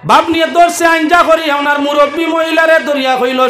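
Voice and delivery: usual speaking voice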